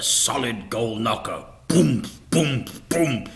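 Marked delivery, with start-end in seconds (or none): 1.7-3.3 louder mock door knocks; booming knocks. A narrator's reading. Narrator voicing the gold knocker, booming. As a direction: voice the heavy knocker.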